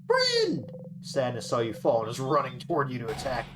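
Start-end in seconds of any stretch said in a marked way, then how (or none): 0.1-0.7 high, loud alarmed call of "Friend!"; a little sister shouting in fright; alarmed cry. Acted. Bonnie cries out in alarm.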